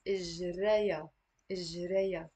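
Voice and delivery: إجريا said in three beats, ij-ra-ya; three syllables. Shown as a demonstration. Teacher drilling إجريا syllable by syllable for students. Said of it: "So it's three syllables".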